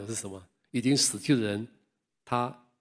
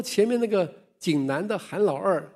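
higher, louder protest; old man arguing with the guards, indignant; 8 semitones higher, 4 dB louder than usual. Retold or imitated. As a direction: point to the man ahead. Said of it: "很生气".

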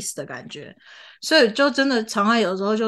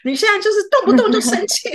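much higher, scolding mom complaint; nagging mother; 9 semitones higher than usual. Retold or imitated. Mother complains her child gets angry since acting class. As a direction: nag like a mother fed up with her kid. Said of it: "我妈就跟我讲说".

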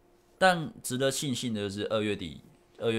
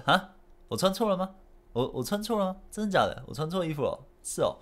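much higher, startled disbelief; mock-stunned, pitched way up; retold shock; 10 semitones higher than usual. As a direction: replay the moment you realise the mistake. Retelling wrong jersey discovery.

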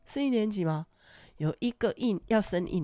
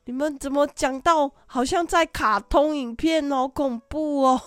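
higher, louder mock-shocked scolding; scolding teacher; 6 semitones higher, 7 dB louder than usual. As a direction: tease them out of it. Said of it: "我说".

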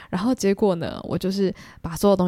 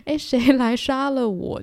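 higher, slowed, half-joking plea of embarrassment; mock despair; 6 semitones higher, 3 dB louder, slower than usual. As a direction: act like you want to vanish, dragging it out. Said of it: "你可能就会真的觉得说". (半开玩笑地拖长语气，假装绝望)

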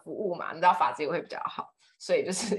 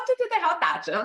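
laughing cashier mimic; 10 semitones higher, 5 dB louder than usual. Mimics cashier adding discount.

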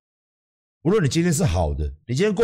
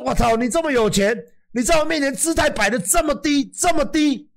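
amazed exclamation; 11 semitones higher than usual. Retold or imitated. Quoting his own amazed reaction.

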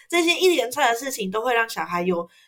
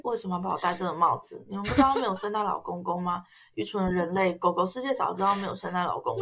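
low, soft mocking dog voice; snooty bored cat; 4 semitones lower, 6 dB quieter than usual. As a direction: scoff at the humans, half laughing. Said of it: "他就在那边耻笑我们说". (低声嘲笑人类的狗狗)

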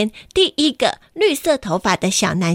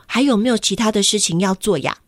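usual speaking voice

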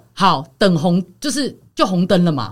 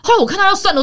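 ordinary talk, nothing marked

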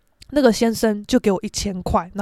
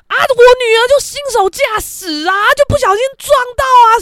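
loud, high defensive mother; mock rude, indignant excuse; imitated bad attitude; 11 semitones higher, 7 dB louder than usual. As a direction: snap back in defence of your daughter. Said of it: "就开始语气超差".

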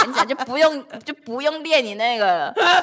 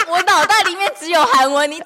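higher, louder laughing excuse; 4 semitones higher, 5 dB louder than usual. Real, not acted. Explaining to her friend she only thinks in Korean, laughing. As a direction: laugh it off, pitched up.

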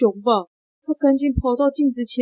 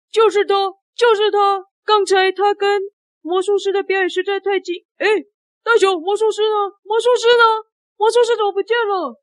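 high-pitched, agitated questioning; accusing boss; 10 semitones higher than usual. Acted. Show boss confronting the bear before a crowd, high agitated accusation. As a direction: demand answers as the boss. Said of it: "对着大熊说".

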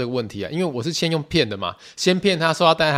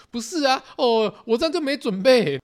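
much higher, fretful mom voice; flustered mom; 11 semitones higher than usual. Retold or imitated. Mom protests she hasn't packed, higher fretful voice. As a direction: squeak out her worry like mom.

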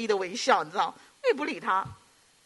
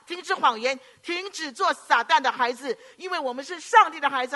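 raised, fervent pulpit delivery; preaching; 6 semitones higher than usual. Acted. Pastor urging the congregation to stop lying, fervent preaching.

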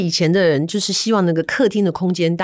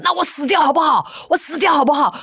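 husband shouting a desperate threat; husband's desperate shout; 8 semitones higher than usual. Retold or imitated. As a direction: threaten it at the top of your voice. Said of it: "他说".

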